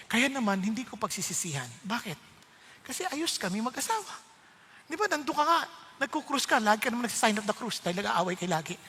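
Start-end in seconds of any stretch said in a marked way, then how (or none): none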